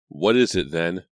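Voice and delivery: loud surly challenge. A narrator's reading. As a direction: bark it at the strangers. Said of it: "he asked in a surly voice".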